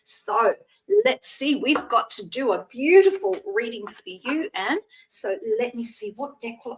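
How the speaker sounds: ordinary delivery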